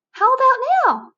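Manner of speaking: put-on high, loud eager kid voice; a fidgety bug asking too soon; squeaky demanding caterpillar; impatient caterpillar.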